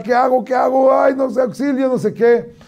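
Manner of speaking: high, panicky mock shout of a cornered son; panicked shout. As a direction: act out the son facing the bear, yelling for help. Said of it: "Y empieza a gritar". (grito de pánico imitado, muy agudo)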